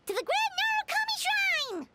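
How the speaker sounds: rally everyone and march off; rallying cry. Acted. Companion rallies the group toward the shrine, excited cry.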